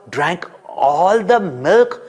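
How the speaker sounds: ordinary delivery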